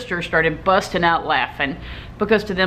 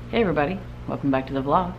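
usual speaking voice

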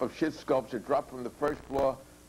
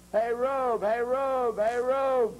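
higher and louder with each call; frantic repeated rallying cry; repeated shout; 9 semitones higher, 4 dB louder, much slower than usual. Acted. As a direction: keep yelling to summon comrades. Ben keeps calling for help.

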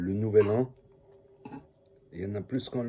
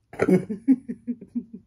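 loud high laugh; 12 semitones higher, 5 dB louder than usual. Real, not acted. Laughs at his own voice.

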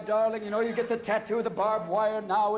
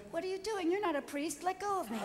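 put-on high, soft little-kid protest; a patted-down kid squirming in protest; five-year-old objecting to the search; child's voice; 12 semitones higher, 5 dB quieter than usual. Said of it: "He's going".

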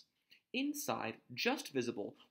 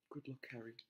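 a much softer murmured farewell; 11 dB quieter than usual. A narrator's reading. Dumbledore bids baby Harry farewell, soft murmur. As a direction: breathe the goodbye under the voice. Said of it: "he murmured".

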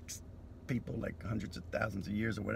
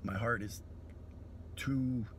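ordinary talk

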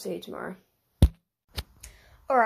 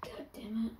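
whispered curse; 7 dB quieter than usual. Real, not acted. Another failed attempt.

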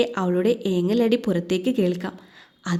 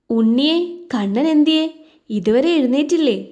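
calling out; 5 semitones higher, 4 dB louder than usual. Acted. Father calls to ask son.

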